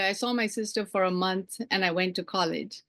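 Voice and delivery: usual speaking voice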